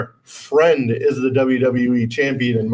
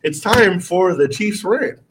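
slow, drawn-out show announcement; segment announcer; 5 semitones higher, slower than usual. Acted. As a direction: announce it like a TV host.

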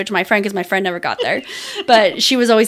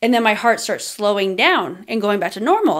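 ordinary talk

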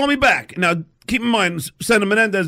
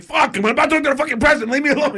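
high, hurried exasperation; exasperated; 9 semitones higher, faster than usual. Retold or imitated. Caller brushes off his date's text, exasperated. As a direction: snap it fast, pitched up and fed up.

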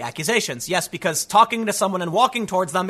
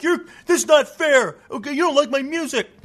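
whiny mock friend; 8 semitones higher than usual. The humming friend protests getting kicked out, in a high whiny mock voice. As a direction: whine it like a kid being thrown out.

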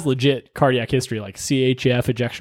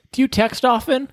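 flirty patient; 8 semitones higher than usual. Retold or imitated. Patient hitting on medic.

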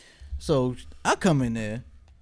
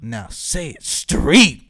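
slow, loud imitation with straight drawn out; dead-serious speech coach; 3 semitones lower, 7 dB louder, much slower than usual. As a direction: imitate the coach's pointed demand, slowly. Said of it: "looked at me dead in my eyes".

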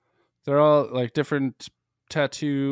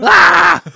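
high, loud gravelly scream; mock rock shout; 16 dB louder than usual. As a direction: yell like a rock singer. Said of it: "he just shouts at me".

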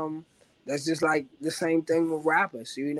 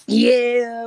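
loud, high raspy "yeah" yelled like a rap ad-lib; signature yeah ad-lib; 10 semitones higher, 11 dB louder than usual. Acted. Rapper drops his trademark ad-lib for the fans. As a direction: belt the yeah like on a track.

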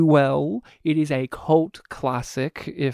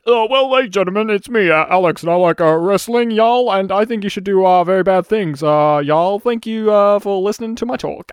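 Alex impression; 5 dB louder than usual. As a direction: do a loud yokel wrestling fan. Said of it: "what do you want to recommend".

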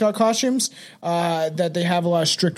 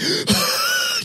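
high startled gasp; sharp gasp. Acted. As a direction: gasp awake in shock. Acted waking from the dead.

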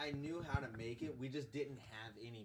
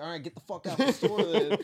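loud, higher mock yell; retold yell; 5 semitones higher, 16 dB louder than usual. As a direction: throw him out loudly. Coworker kicks customer out.

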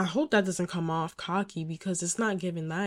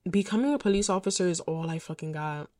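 normal talking voice

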